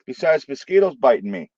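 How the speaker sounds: usual speaking voice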